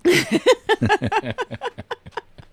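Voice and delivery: high loud laugh; condescending chuckle. Real, not acted. Laugh at bit.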